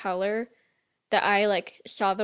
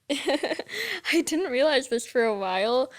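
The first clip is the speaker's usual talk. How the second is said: laughing through the words; 4 semitones higher, slower than usual. Real, not acted. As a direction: giggle while saying it. Same phrase redone laughing.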